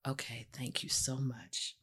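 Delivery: very soft, low, near-whisper; hushed, relieved thank-you. Retold or imitated. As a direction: sigh out gratitude, barely voiced. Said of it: "It was like".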